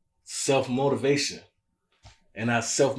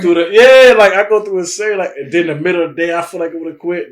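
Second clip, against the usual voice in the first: much higher and louder exasperation; worked-up outburst; 5 semitones higher, 14 dB louder than usual. Real, not acted. Man venting to his podcast guest about wanting to quit, exasperated. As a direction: throw up your hands at the daily grind. Laughing agreement about going insane.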